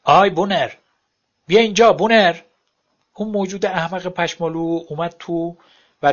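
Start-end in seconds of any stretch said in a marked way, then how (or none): 0.0-2.4 loud, high call across the house; calling the dog. A narrator's reading. Lynn calling her dog Bonner inside, loud high call. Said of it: "اون داد زد".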